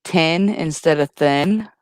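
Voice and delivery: "Tin" with a plain t, slow and drawn; mocking his th. Shown as a demonstration. Teasing a friend about how he says thin, slowly. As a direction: drawl "Tin" then "thin" apart. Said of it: "you say thin instead of thing".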